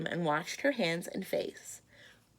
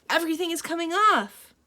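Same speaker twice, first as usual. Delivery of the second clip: higher, louder happy reply; relieved; 9 semitones higher, 8 dB louder than usual. Acted. Bridget shouting from the bath, relieved. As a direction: call back in relief. Child answers happily.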